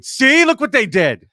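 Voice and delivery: high, loud, drawn-out mock protest; vindicated arrestee. Arrested woman watching bodycam footage back, mock vindicated. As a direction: crow it like a wronged woman proving her point.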